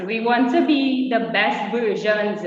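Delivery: usual speaking voice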